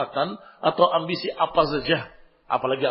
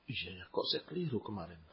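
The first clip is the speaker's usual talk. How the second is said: much lower and softer, musing to oneself; soft self-reflective aside; quiet admission; 9 semitones lower, 16 dB quieter than usual. Acted. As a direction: concede under your breath, realizing.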